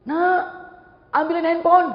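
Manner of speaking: high, loud holler; parent calling out. Acted. Mother asking child for the phone, calling out. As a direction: shout a request across the house.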